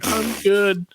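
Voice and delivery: hammed-up nervous politeness; mock nervous reply. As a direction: answer like you're scared of him. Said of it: "I'm like".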